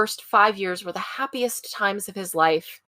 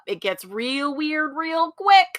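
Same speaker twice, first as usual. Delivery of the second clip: slow emphatic; 8 semitones higher, slower than usual. Real, not acted. Emphatic punchline.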